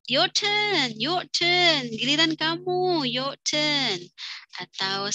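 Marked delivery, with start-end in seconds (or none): 0.1-4.0 higher prompting teacher voice. Shown as a demonstration. Teacher inviting a student to speak, higher pitched. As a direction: hand the turn over. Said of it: "misalnya kita minta".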